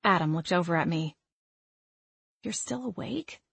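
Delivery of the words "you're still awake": hushed, much softer question rising high at the end; whispered question. A narrator's reading. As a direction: ask it very softly, as if someone's asleep. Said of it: "I whispered".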